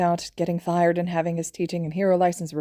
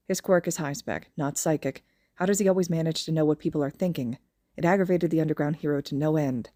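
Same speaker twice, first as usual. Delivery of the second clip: ordinary delivery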